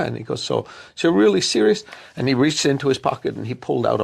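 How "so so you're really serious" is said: doubting interviewer. Retold or imitated.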